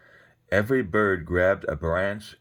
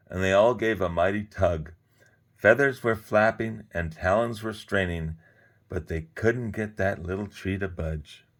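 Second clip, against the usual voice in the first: ordinary delivery, nothing marked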